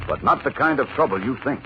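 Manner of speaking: usual speaking voice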